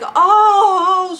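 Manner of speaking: high, loud, drawn-out oh; hammed-up shock; shocked reaction. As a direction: exclaim in mock horror.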